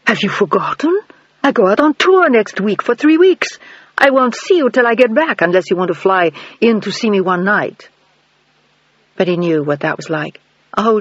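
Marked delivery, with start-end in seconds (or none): 0.1-7.9 put-on higher, brighter-pitched dancer girlfriend, a bit put out; Sasha's voice. A narrator's reading. Girlfriend reminding him she's on tour, higher voice.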